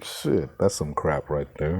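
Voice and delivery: ordinary talk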